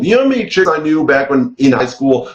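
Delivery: normal talking voice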